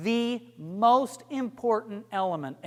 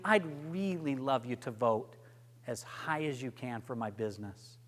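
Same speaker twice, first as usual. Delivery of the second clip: softer, sincere plea; caring; 8 semitones lower, 10 dB quieter than usual. Shown as a demonstration. Coach demos a caring ask to the judges. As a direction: say it like you truly care. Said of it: "Something that shows you care".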